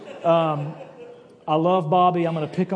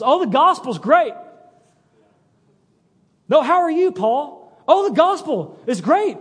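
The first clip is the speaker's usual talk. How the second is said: higher, brighter-pitched upbeat Paul, louder and eager; 7 semitones higher, 3 dB louder than usual. Retold or imitated. Paul cheerfully answering the Philippians, eager and higher. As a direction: gush that the gospel is unstoppable.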